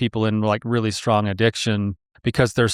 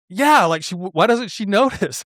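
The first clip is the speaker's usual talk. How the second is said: imitated baffled husband, higher pitch; exasperated; 7 semitones higher than usual. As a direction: act out an indignant complaint, laugh creeping in. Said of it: "he's, like, going crazy".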